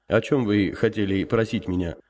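usual speaking voice

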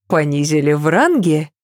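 ordinary delivery, nothing marked

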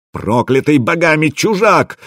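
high-pitched outraged cry; wailing yell. A narrator's reading. Rasmus curses the stranger before everyone, high wailing yell. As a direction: wail the curse, pitched well up. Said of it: "завопил Расмус".

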